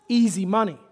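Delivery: usual speaking voice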